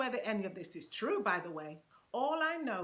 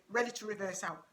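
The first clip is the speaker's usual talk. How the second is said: normal talking voice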